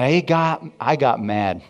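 ordinary talk, nothing marked

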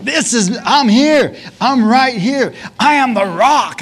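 high, loud, insistent proclamation; fired up. Retold or imitated. Preacher imagines Jesus announcing himself at the feast, fired up. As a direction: shout it out to be noticed. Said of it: "I believe he got fired up".

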